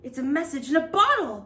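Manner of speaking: excited cry. Acted. Pirate crew spotting the bottle, crying out. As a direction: call it out together. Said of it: "they all cried".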